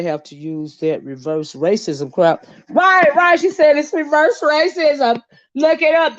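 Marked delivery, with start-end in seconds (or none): 2.7-6.2 mock commenter. Mocking the commenter's argument.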